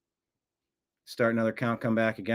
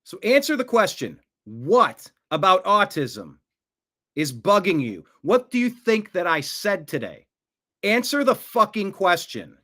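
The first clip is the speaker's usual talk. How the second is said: higher-pitched, exasperated demand; angry challenge; 5 semitones higher than usual. Real, not acted. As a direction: press the troll angrily for an answer. Host challenges troll for an answer.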